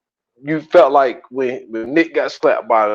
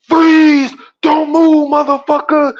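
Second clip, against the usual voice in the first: mock cop shout; 13 semitones higher, slower than usual. Terrence storming the store, retold cop shout. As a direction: burst in yelling like a cop.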